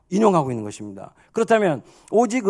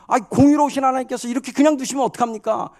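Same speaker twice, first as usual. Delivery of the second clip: high-pitched protest to God; acted prophet remonstrating; challenging, arguing; 7 semitones higher, 3 dB louder than usual. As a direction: argue it insistently, pitched high. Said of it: "이러면서 막 따져요".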